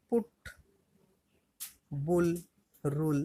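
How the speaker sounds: পুট, বুল, রুল each with an "oo" vowel; U pronounced "oo". Shown as a demonstration. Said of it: "ইউর উচ্চারণ কেমন হবে". (পুট, বুল, রুল-এ U-এর উচ্চারণ "উ")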